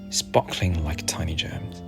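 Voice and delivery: ordinary delivery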